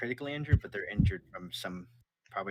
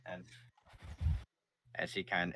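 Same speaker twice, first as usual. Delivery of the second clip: usual speaking voice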